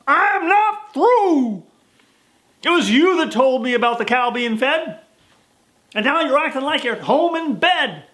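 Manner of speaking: loud, high furious preacher; angry shout. Acted. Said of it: "which made him mad".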